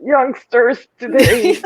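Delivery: put-on slow old granny, laughing. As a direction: croak it like a grumpy granny. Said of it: "Do the granny voice".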